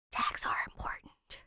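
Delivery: whispered aside; soft conspiratorial whisper. Shown as a demonstration. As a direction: breathe it as a secret reminder. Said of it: "whispered reminder".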